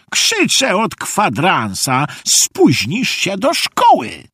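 high, slow, stretched-out scolding; exasperated scolding. A narrator's reading. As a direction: call out to rouse a sleepy grandson. Grandpa scolds sleeping grandson. (wysokie, przeciągłe ponaglanie zirytowanego dziadka)

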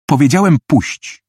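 insistent, irritated order. Acted. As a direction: demand it with mounting impatience. Attacker repeats his order angrily.

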